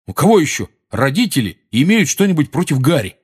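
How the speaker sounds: daring anyone to object; threatening. A narrator's reading. Ron challenging the other boys over Harry, threatening. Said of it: "угрожающе спросил он".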